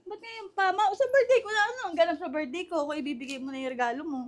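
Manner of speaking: high, loud whine of protest; exclaimed, high-pitched objection. Real, not acted. Protests about early birthday gift.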